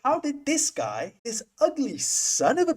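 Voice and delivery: high, slow, incredulous mock voice; mock amazed friends. Vlogger imitating his stunned friends, high and slow. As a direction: ham up their stunned disbelief.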